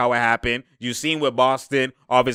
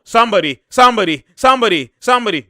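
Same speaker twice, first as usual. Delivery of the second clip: calling out; 6 semitones higher, 7 dB louder than usual. Real, not acted. Calling out to players.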